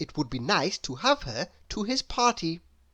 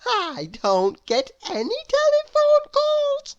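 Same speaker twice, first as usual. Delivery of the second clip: cheerfully refuse, very high; contrary Miss Contrary; 4 dB louder than usual. Acted. Miss Contrary answers Mister Small, very high sing-song. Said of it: "she said".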